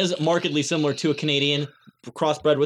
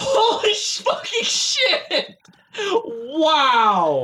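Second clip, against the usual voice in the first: much higher, laughing disbelief; real surprised laughing yelp; shocked outburst; 9 semitones higher, much slower than usual. Real, not acted. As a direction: blurt it out in stunned surprise. Shocked at ranking reveal.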